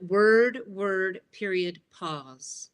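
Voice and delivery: each "word" set apart evenly, "period, pause" slowing and falling; measured beats on "word, word", a drawn-out drop on "pause"; trained broadcast cadence. Shown as a demonstration. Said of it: "people tend to speak in those cadences when they're trained".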